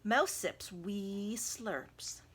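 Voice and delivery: ordinary delivery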